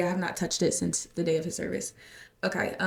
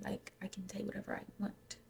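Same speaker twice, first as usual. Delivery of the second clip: whispered aside, much softer, almost no voice; 16 dB quieter than usual. Real, not acted. Host whispering a cheeky aside to listeners, whisper. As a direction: drop to a secret whisper. Aside about her own show.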